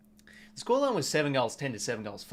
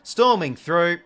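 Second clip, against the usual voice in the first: exasperated; 5 semitones higher, 11 dB louder, much slower than usual. Real, not acted. Watching opponent break through.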